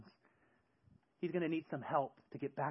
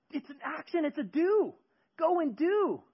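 high, loud impassioned sermon; fervent preaching; 14 semitones higher, 9 dB louder, slower than usual. Acted. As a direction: preach it with full fire. Preaching at full force.

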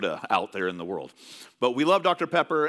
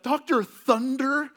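put-on high, slow child's voice stretching out the name, laughing on really; a kid mocking the knockoff brand; dad imitating his daughter's disbelief at the bottle; incredulous little girl; 11 semitones higher, 4 dB louder, much slower than usual. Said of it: "She was like".

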